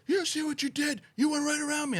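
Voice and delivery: high-pitched mock outrage; mad guy yelling. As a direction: imitate an angry driver hollering. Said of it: "the guy was mad about something".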